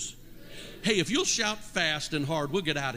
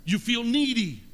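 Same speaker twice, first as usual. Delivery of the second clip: full-force preaching; 4 semitones higher than usual. Acted. Preacher urging the congregation to be thankful, full force. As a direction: preach it hard, building to a shout.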